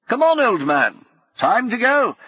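brisk, high, loud officer's call; rally a reluctant friend with bluster; hearty gentleman adventurer. Acted. Brummond urging Paul on.

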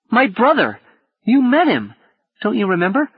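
put-on high, astonished child; wide-eyed boy. A narrator's reading. Gally amazed Paul forgot his brother, high surprised child. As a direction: exclaim in disbelief like a small boy. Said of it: "Gally stared, wide-eyed".

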